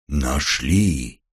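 low, booming from a barrel. A narrator's reading. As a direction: speak as if inside a tank. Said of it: "утробный голос, звучащий словно из бочки".

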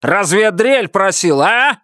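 a husband ordering his wife around, menacing; bullying order. A narrator's reading. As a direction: bark it like a tyrant. Buster furious.

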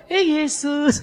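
sudden startled cry; hammed-up gasp of surprise; mock surprise. Mary finds the baby beside her, mock surprised.

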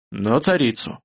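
ordinary delivery

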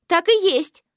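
exclaiming. Acted. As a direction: blurt it out indignantly. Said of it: "воскликнула Аврора".

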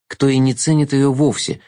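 ordinary delivery, nothing marked

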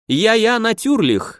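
high, slow German agreement; cheerful German yes, drawn out; German accent. A narrator's reading.

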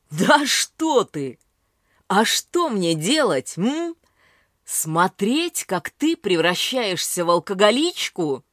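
angry. A narrator's reading. Son yells at drunk mother.

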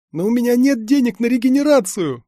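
high, loud panicked cry; near scream. A narrator's reading. Lad threatened with broken limbs, nearly screaming. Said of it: "почти закричал парень".

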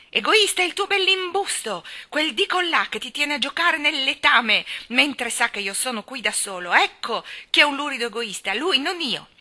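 put-on high, loud indignant child; shrill-tempered accusation, full force; outraged rant. Colin blames Deacon left him alone, outraged.

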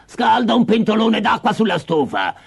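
witch's order, starting high then dropping; bossy witch. Acted. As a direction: order the servant girl about as a wicked witch. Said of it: "la vecchia strega disse a Gretel". (strega che dà ordini)

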